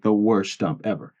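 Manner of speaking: normal talking voice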